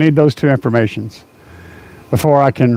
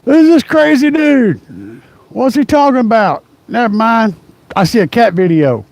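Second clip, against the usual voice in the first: imagined viewers; 7 semitones higher than usual. Pretend. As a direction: play a confused drive-by viewer. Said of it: "They're just popping in and like".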